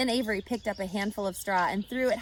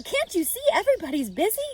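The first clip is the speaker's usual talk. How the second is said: scolding; 10 semitones higher, 7 dB louder, faster than usual. A narrator's reading. Mother scolds Fern.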